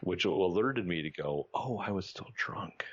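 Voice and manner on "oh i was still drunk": whispered realization. Retold or imitated. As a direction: whisper the confession slowly. Realizing he was drunk.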